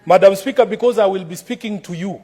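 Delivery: ordinary talk, nothing marked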